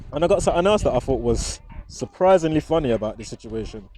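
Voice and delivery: ordinary delivery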